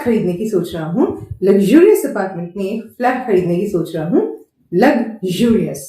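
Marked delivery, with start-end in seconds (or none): 4.7-5.8 Luxurious drawn out very slowly; slow model word. Shown as a demonstration. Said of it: "It is not luxurious".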